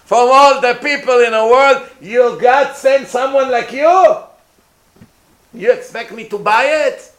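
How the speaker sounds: high, loud, mocking disbelief; Paro scoffing. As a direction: sneer at Moshe as a fraud, raising the voice. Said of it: "As soon as he sees him".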